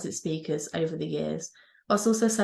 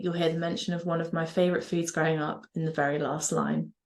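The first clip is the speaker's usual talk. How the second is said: normal talking voice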